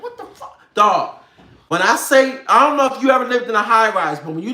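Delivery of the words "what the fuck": breathless shocked whisper; startled at night, whispered. Retold or imitated. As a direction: hiss it out startled. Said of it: "I was like".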